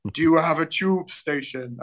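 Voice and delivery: slow, lordly questioning; Londoner as king. Retold or imitated. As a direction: quiz him like a stern king. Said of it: "They're like".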